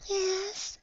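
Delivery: high, small character voice; little pony's voice. A narrator's reading. Shy pony glaring at giggling friend, small voice. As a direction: say it in a tiny squeak. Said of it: "she says, looking at me".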